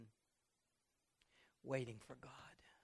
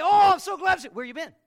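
much higher and louder, overdone delight; gushing mock welcome; 11 semitones higher, 21 dB louder, faster than usual. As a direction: ham up the sugary welcome. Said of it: "You'll say".

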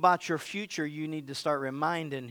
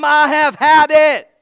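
high, loud, drawn-out declaration; defiant shout; 11 semitones higher, 18 dB louder, slower than usual. Acted. Preacher modelling what to tell him, defiant shout. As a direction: shout it slowly, fed up and done.

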